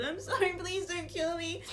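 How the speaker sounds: put-on high, teary pleading kid; crying child. Crying kid begs the player not to kill him. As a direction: sob out the apology like a little boy. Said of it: "he is currently crying, um, and he says".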